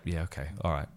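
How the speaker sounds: quiet sheepish laughing; quiet embarrassed chuckle. Retold or imitated.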